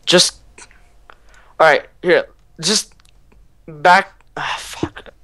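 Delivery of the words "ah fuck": whispered curse; whispering. Real, not acted. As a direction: breathe the curse silently. Hiding from enemies nearby.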